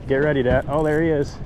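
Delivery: normal talking voice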